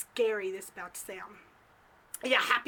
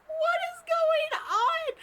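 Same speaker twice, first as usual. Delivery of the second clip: very high, drawn-out wail; loud, slow, hammed-up bewilderment; exasperated outcry; 5 dB louder than usual. Narrator reacts to Gary turning the lady away, exasperated wail.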